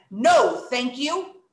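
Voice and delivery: loud NO, firm angry refusal. Shown as a demonstration. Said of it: "good job sounding angry".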